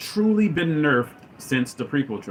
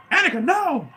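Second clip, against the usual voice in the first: high, loud alarmed cry of a name; mock Obi-Wan cry; 4 dB louder than usual. Retelling Obi-Wan stopping Anakin, high mock alarm. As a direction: squeal it like a panicked mentor. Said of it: "Obi-Wan goes".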